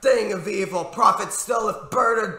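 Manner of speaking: high, loud, half-sung imploring; desperate impassioned plea. A narrator's reading. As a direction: build to a ringing desperate shout. Desperately begging the raven.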